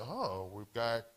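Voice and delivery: lightly voiced pest control guy. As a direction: sound like a slow know-it-all exterminator. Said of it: "pest control guy came in and said".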